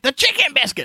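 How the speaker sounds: put-on high, exasperated old-man voice. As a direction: grandpa insisting on his order. Grandpa insisting.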